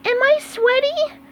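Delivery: very high cartoon sponge voice; SpongeBob voice. SpongeBob asking his teammates after practice, squeaky.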